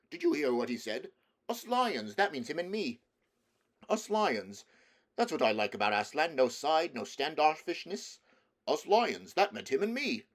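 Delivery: a lion bragging to everyone he meets; excited lion showing off; proud boasting lion. Acted.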